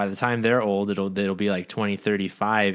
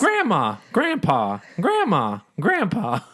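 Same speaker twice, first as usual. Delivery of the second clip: high, evenly spaced falling names; mock roll call; 9 semitones higher, slower than usual. Naming covid dead for days, mock roll call. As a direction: call each name high, letting it drop.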